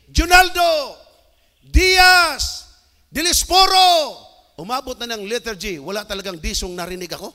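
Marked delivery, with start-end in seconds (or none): 0.1-4.2 high loud roll-call shout; calling out names. Retold or imitated. Announcer calling graduates up in the auditorium, shouting the name. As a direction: bellow the name across the hall.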